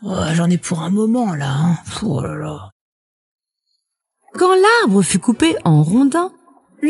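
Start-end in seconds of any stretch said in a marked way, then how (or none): 0.0-2.7 aparté las, bas et étouffé; loup découragé. A narrator's reading. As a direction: soupire à mi-voix devant la corvée. Le loup découragé devant l'arbre. (marmonnement accablé qui s'éteint)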